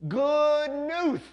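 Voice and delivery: high, loud cry with a drawn-out "good"; excited lisping shout. A narrator's reading. Bernie running up to the boys, shouting excitedly. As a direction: shout it at the top of your lungs, lisped. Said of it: "shouting at the top of his lungs, his voice cracking with excitement".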